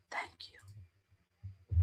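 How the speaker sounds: sigh, then a choked whispered thank you; tearful whispered thanks to the chat; moved whisper. Real, not acted. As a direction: breathe it out, too touched to speak aloud. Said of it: "I'm gonna cry".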